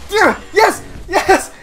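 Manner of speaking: high, loud whooping celebration; elated cheering. Real, not acted. Player winning a very close game, elated. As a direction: cheer wildly at a narrow win.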